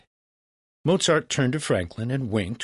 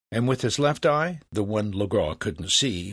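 ordinary delivery, nothing marked

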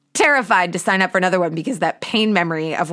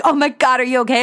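higher, louder worried gasp; concerned bystanders; 6 semitones higher, 4 dB louder, faster than usual. Retold or imitated. Strangers rush up to the sobbing runner, higher and alarmed. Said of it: "They're like".

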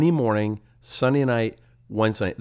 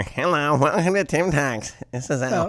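higher Jerry Lewis-like nasal welcome; stuffy nasal voice; 5 semitones higher than usual. Shown as a demonstration. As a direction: squeeze the words through a blocked nose. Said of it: "so I didn't sound like this anymore".

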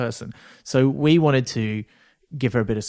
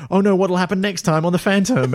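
hammed-up serial narrator, higher and louder, laughing; mock cliffhanger; 8 semitones higher, 7 dB louder than usual. Host mocking The Phantom's cliffhanger panel, hammed-up and laughing. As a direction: tease the cliffhanger like an old serial announcer.

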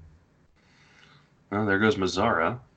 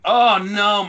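dismayed outburst; 12 semitones higher, 10 dB louder than usual. Real, not acted. Rival sniped his draft pick.

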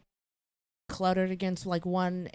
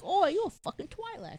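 mock Cockney; 11 semitones higher than usual. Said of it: "say that again?".

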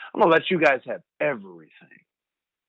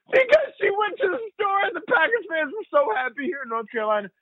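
laughing. Real, not acted. Laughing while retelling.

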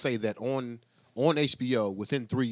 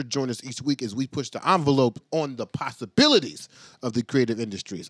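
upbeat podcast host intro; announcer; 6 dB louder than usual. Acted. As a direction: hype up the show opening.

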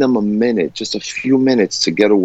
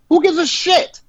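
high-pitched indignant outburst; exasperated rant; slower than usual. Real, not acted. Fed up with people posting about deleting friends, high exasperated outburst. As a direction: blow up at attention seekers, pitch soaring. Ranting at Facebook deletion posts.

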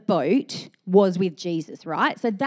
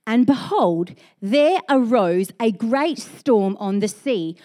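slow, weighty Bible reading; scripture reading; 2 semitones higher, slower than usual. Acted. Pastor reads the storm story aloud, slow and weighty. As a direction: read slowly, letting each phrase land.

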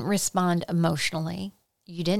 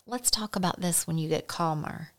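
softly, unbothered; calm, soft reply; 7 dB quieter than usual. Shown as a demonstration. To an attacker, calm soft reply. Calm non-defensive replies.

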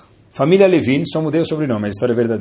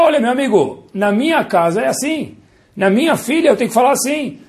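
firm parent; 9 semitones higher than usual. Acted. Parent laying down house rules.

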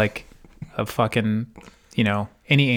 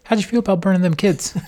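higher, louder and fast through a laugh; laughing mock question; 9 semitones higher, 5 dB louder, faster than usual. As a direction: toss the line out high while laughing. Jon questions Dany, laughing.

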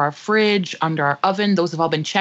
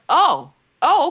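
imitated startled oh, higher and louder; startled realization; 8 semitones higher, 5 dB louder than usual. Leader spotting a beetle at his feet, startled. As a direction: voice leaps up in mock surprise.

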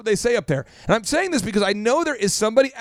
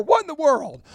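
frustrated outburst; 7 semitones higher than usual. Acted. Imitating a frustrated Tulsa outburst.

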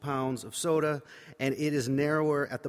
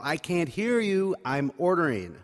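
yelling back; 5 semitones higher, 4 dB louder than usual. Retold or imitated. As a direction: yell it over the shoulder, annoyed. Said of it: "turn my head and yell".

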